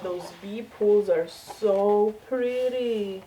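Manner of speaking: ordinary talk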